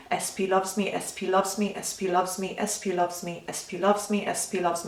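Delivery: quick, soft, monotone loop; robotic repetition. Shown as a demonstration. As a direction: rattle it off softly like a machine on repeat. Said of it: "like a robot programmed to repeat a phrase".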